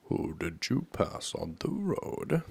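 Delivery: slow, lightly voiced king; a hint of a king, asking slowly; questioning king. A narrator's reading.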